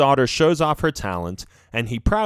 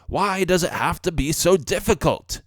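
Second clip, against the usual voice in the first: higher-pitched frustrated professor; frustrated wondering; 6 semitones higher than usual. A narrator's reading. Professor stuck on his formula, frustrated wondering. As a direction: wonder aloud in a raised, exasperated voice. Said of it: "he wonders".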